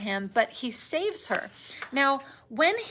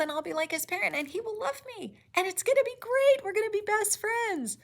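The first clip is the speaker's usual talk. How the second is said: put-on much higher, gushing childish glee; giddy daydream; 10 semitones higher, faster than usual. Teacher mocking the creature's hope of friendship, giddy high. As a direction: gush like a lonely dreamer.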